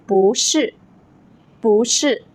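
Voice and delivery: 不是 with 不 rising in second tone; bú shì, rising then falling. Shown as a demonstration. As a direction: lift 不 before the falling 是. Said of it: "hear the same change in these examples".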